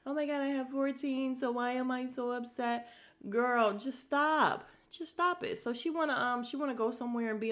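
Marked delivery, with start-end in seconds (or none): 0.1-2.9 higher-pitched mock lament; lightly mocking quote, pitched up; imitating her. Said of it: "Like she said". 3.3-5.6 drawn-out, loud plea rising high; fed-up high-pitched telling-off; exasperated scolding. Real, not acted. Exasperated scolding of woman she watches.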